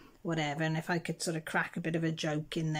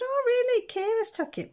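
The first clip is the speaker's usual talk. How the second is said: much higher, louder, a hammed-up gasp of surprise; mock surprise; 8 dB louder than usual. Daughter humouring her mum with exaggerated amazement. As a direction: play it up as if amazed. Feigning surprise at mum's tale.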